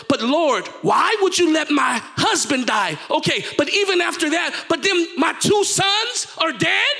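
impassioned questioning, rising at the end; preached plea to God. Acted. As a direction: cry out to God as the bereaved widow.

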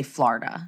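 "Florida" with an open AH, FLAR-duh; Jersey Florida. Shown as a demonstration. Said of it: "people in Jersey actually say".

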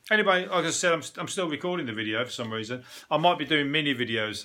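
usual speaking voice

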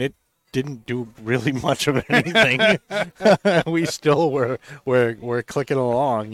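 laughing through the words. Real, not acted. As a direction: breaks into real laughter mid-sentence. Laughing at the tobacco story.

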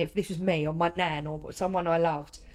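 ordinary delivery

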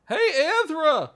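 high, loud call across the water. Acted. Snake calling loudly to its snake friend Anthra. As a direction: shout for a friend far off.

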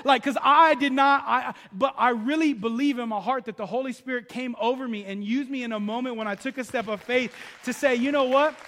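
loud, driving sermon voice, pushing every phrase; fervent preaching. Acted. Pastor preaching to the congregation, loud and fervent. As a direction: preach it out full force to the church. Preaching builds to full force.

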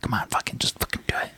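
hissed whisper to egg him on; urgent whisper. Retold or imitated. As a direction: whisper it so the others can't hear. Urging Wardlow secretly nearby.

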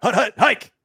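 loud, high hut-hut-hike call; quarterback calling cadence. Retold or imitated. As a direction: call the snap at the line. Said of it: "quarterback cadence at line of scrimmage".

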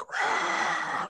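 voiceless rushing crowd noise; imitated crowd roar. Storyteller imitating the din of a crowd, voiceless roar. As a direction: breathe out a distant stadium roar.

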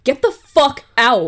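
loud, slow mock yell of get out; half-joking shout to flee; mock panic yell. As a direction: bark it like you're bolting for the door. Panicked crew fleeing joke.

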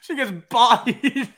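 high, loud words through laughter; cracking up while telling; laughing. Real, not acted.

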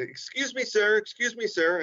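high, calling out excuse me sir; woman's voice calling. Retold or imitated. Comedian imitating a saleswoman calling after him in a store. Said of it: "I heard a woman's voice say".

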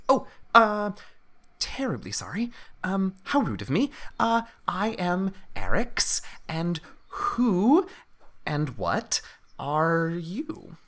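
high, whispery, polite little kobold; apologetic kobold. Acted.

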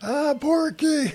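high, slow drawn-out call; mock scream. Mom calls her son's name, high and slow, mock scream. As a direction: stretch out the call like a mom yelling.

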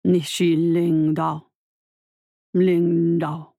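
low, very slow and scornful; slow deep scornful growl. A narrator's reading. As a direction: drag each word out with contempt. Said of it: "The slow voice continued. It sounded scornful".

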